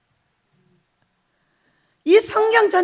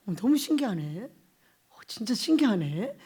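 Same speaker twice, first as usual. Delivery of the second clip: wondering to oneself; 6 semitones lower, 7 dB quieter than usual. Retold or imitated. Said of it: "그러면서 또 깨달아지는 거죠".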